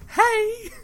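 very high squeaky fairy call; tiny tinkling fairy voice. Retold or imitated. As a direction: chirp it like a tiny game fairy. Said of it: "his best, uh, fairy impression from Ocarina of Time".

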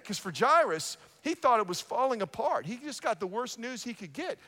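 ordinary talk, nothing marked

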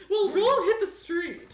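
high, loud, slow character call. Acted. Star telling date to roll, high loud voice. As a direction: push it up high and draw it out. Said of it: "he says".

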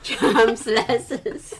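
very slow, laughing "Champs-Élysées" the English way; giggling slow Brit "Champs-Élysées"; mock anglicized. Shown as a demonstration. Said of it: "开玩笑的英国人把它英文化以后怎么读".

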